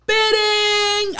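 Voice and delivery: very high, ringing bid call; loud yell. Retold or imitated. Bidder the auctioneer didn't see, high loud yell. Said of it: "you have that loud ass voice".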